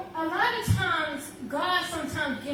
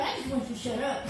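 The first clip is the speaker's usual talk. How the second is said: mom's exasperation; 6 semitones lower, 4 dB quieter, faster than usual. Retold or imitated. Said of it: "she was like".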